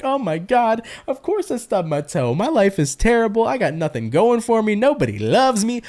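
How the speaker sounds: high, whiny overdramatic self-pity; dramatic younger self. Retold or imitated. Reader mocking her dramatic younger self, high and whiny. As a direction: wail about a stubbed toe like the world ended. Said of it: "I was just dramatic".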